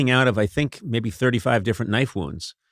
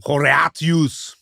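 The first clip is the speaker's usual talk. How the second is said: slow, higher, hammed-up Latin "Horatius"; classical Latin; 4 semitones higher, much slower than usual. Contestant jumping in first with classical Latin, overblown and drawn out. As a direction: stretch ho-RAH-tyoos out theatrically. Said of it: "how would we pronounce this in classical Latin".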